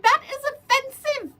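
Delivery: hammed-up demon complaining to a ghost; mock offended demon. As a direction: play a demon outraged by Bible quotes. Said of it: "And I'm like".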